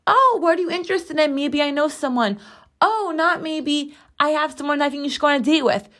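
high-pitched mock polite suggestion; hypothetical matchmaker. Imagined matchmaker offering a date, mock politeness.

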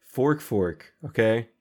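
ordinary talk, nothing marked

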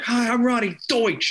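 high, mock pitchwoman catchphrase; Ronnie Deutsch. Kids stealing at the mall, high mock commercial catchphrase. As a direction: call it out high, hitting "Deutsch". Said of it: "it was what we would say".

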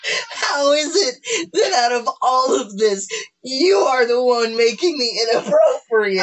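talking through laughter; high, laughing disbelief. Real, not acted. Laughing at an innuendo.

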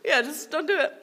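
high, laughter-filled words; laughing. Real, not acted. Podcaster advising on homework after own joke, laughing. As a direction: talk through the giggles.

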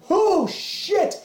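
sudden high yelp of alarm; retold alarmed cry. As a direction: blurt it high as pain hits. Retold cry under car.

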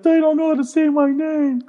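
high whiny little-brother voice; hammed-up childish crying complaint; mock tearful child. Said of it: "he used to come home crying".

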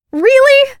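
put-on girl outraged at the thrown knife; indignant demand. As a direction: hurl it back at him. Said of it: "she demanded".

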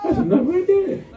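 high, loud repeat; preacher repeating his point to the church, shouted; emphatic shout. Real, not acted. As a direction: drive it home loudly. Preacher repeats line, louder and higher.

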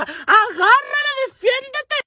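high, drawn-out scream; mock-hysterical yelling from behind a fence; rowdy shrieking. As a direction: squeal it like an excited onlooker. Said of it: "las pinches cuatas bien escandalosas".

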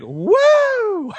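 high joyful woo; excited whoop. Retold or imitated. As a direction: let out a gleeful woo. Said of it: "I'm getting this laughing feeling of".

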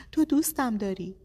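high, loud insistent pleading; loud, high, desperate insistence; urgent plea. A narrator's reading. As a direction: press him hard to admit it. Begging husband during countdown.

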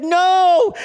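high, loud shouted no; team shouting back. Retold or imitated. Players answering their coach, one loud shouted no.

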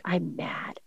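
tiny, hushed imitation of an upset kid; sulky child. Son answering mom's apology, sulky child. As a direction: sulk very softly, as a hurt child would.

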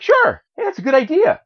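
high-pitched, chirpy agreement; put-on high cartoon voice; eager Jimmy. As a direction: pipe up high like a keen young sidekick. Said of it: "Jimmy's like".

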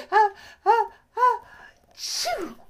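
loud, high hammed-up sneeze; big sneeze. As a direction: build the ah-ah up and burst out the shoo. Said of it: "he gave a big sneeze".

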